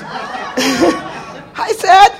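high, loud call across the room; cheerful loud hello to a friend she recognizes. Real, not acted. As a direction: sing out his name as you spot him. Waving and calling out to Cedric.

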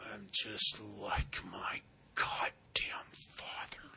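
slow, broken whisper, half the voice gone; horrible whisper. Retold or imitated. Sponsee admits to his sponsor he resembles his father, slow whisper. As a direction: breathe it out slowly like a confession. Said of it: "he says in this horrible whisper".

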